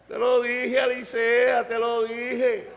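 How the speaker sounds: drawn-out, teasing "told you so" delivery; mocking laughing sergeant. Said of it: "al sargento riéndose".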